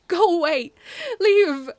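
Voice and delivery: very loud, high, spent yell; desperate yell. A narrator's reading. Narrator driving the men away, exhausted desperate yell. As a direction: heave it out at your limit. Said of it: "I heaved, though at my limit physically".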